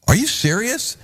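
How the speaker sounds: high-pitched disbelieving question; incredulous. Retold or imitated. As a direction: retell a disbelieving reply, pitch leaping up. Retold stunned reply to spirit talk.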